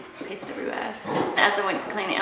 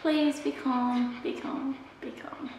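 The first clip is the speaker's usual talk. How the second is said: fading plea; 4 semitones higher, 6 dB quieter, slower than usual. Real, not acted. Pleading with puppy.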